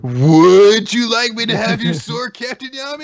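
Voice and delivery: put-on corny voice milking a joke; hammed-up punning. Hamming up the tree pun as Asta.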